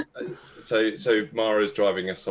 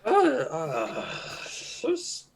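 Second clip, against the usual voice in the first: bodyguard voice pitched higher, unsure; odd high voice; 10 semitones higher, slower than usual. Acted. Bodyguard suddenly voicing himself strangely, higher put-on voice. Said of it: "I don't know why I'm talking like this".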